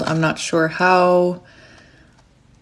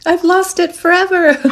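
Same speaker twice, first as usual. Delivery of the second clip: high-pitched hammed-up wail; playful, very high panicked cry; mock panic; 11 semitones higher, 3 dB louder than usual. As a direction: wail it as a comic overreaction. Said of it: "I immediately panic".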